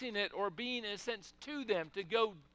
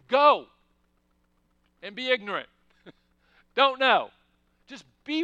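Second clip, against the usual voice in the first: loud exhortation; 3 semitones higher, 12 dB louder than usual. Real, not acted. Preacher exhorting the congregation.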